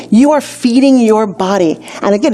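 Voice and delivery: usual speaking voice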